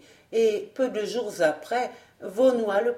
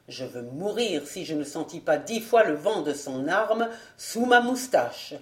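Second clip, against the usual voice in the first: ordinary delivery, nothing marked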